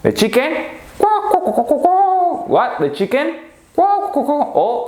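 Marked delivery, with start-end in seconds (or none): 1.0-2.5 high-pitched squawking hen; chicken clucking. Pretend. Teacher acting out the animal, chicken clucking. 3.7-4.5 high-pitched squawking hen; chicken clucking. Pretend. Teacher acting out the animal, chicken clucking.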